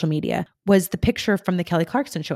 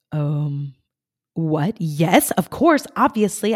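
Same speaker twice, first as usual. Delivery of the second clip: rising into a louder, giddy yes; stunned then thrilled; 4 dB louder, much slower than usual. Retold or imitated. Speaker accepting a surprise keynote offer, stunned then thrilled. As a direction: go from dumbfounded to overjoyed.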